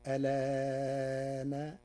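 "ألان" said alaaaana, long middle vowel held level; "ألان" as a-LAAAA-na on an even chanted note; medium elongation. Shown as a demonstration. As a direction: stretch the aa of "ألان" slowly, first hamza kept. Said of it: "التوسط فيقرأها هكذا".

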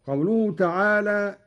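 usual speaking voice

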